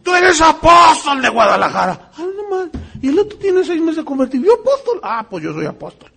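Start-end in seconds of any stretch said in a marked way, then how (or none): 0.0-2.1 loud teasing call across the room; loud playful shout at a congregant. Real, not acted. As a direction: call him out, booming. Preacher calls out a congregant.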